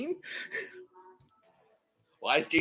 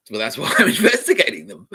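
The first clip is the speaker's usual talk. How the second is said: laughing; 3 semitones higher, 8 dB louder than usual. Retold or imitated. Retelling his own reply to the man.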